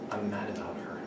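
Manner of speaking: breathy whisper of a confession; hushed loving whisper. Shown as a demonstration. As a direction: whisper it like a secret crush. Teacher demos a lover's line.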